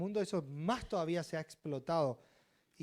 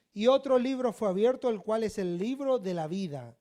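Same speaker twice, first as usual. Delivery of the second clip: forceful, raised pulpit reading; scripture reading; 4 semitones higher, 7 dB louder than usual. Real, not acted. Reads scripture aloud with preaching force.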